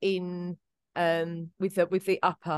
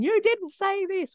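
high, louder, drawn-out mock protest; mock screaming; 6 dB louder, slower than usual. Podcaster imitating listeners screaming at what was left out, mock screaming. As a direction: play a screaming listener, slow and high-pitched. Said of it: "some of you out there screaming".

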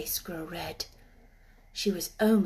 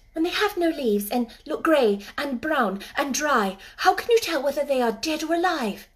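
put-on young girl voice; 6 semitones higher, 5 dB louder than usual. Mary speaks.

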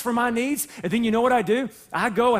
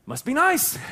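higher, snide "must be nice"; sarcastic; 7 semitones higher than usual. Retold or imitated. Pastor voicing envy of God's promises, snidely. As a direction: roll your eyes at someone else's good fortune.

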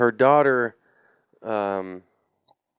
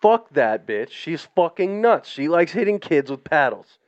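put-on defiant kid; 6 semitones higher, 5 dB louder than usual. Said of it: "I was a hardhead".

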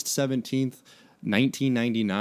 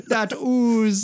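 goofy turtle voice; 12 semitones higher, 5 dB louder than usual. Pretend. Joking turtle voice.

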